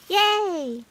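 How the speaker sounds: high bright little cheer; a character's delighted yay; cheery yay. Acted. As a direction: squeal it happily. Reading a story, cheering in voice.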